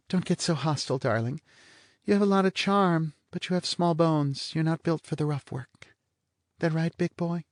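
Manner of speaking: a bored blonde toying with him; lazy blonde. Acted. Blonde teases Vanier about his sex appeal, languid and high.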